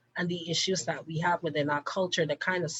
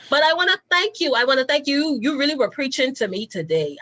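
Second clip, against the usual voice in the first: loud, much higher, gushing; excited thanks; 8 semitones higher, 8 dB louder than usual. Real, not acted. Host gushing thanks to the guest for preaching, loud and high. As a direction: gush with gratitude.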